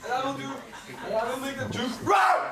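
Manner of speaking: high, loud gleeful boast; giddy, shouting brag; laughing brag. Acted. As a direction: crow about the roar with a laugh. Bottom bragging with laughter.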